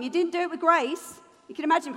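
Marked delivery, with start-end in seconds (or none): none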